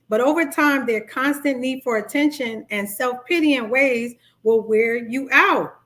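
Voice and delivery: ordinary talk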